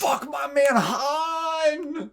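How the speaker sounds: high shrieking outrage; outraged shout. Retold or imitated. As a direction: yell it out in shock. Outraged quote about Han's death.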